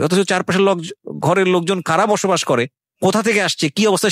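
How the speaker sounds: ordinary talk, nothing marked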